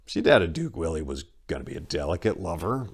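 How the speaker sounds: plain reading voice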